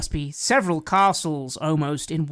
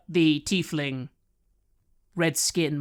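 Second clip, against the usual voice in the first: ordinary talk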